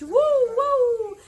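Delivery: high, loud yelping woo; barking dog. Acted. Daunted dog barking, high loud yelps. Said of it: "who barked".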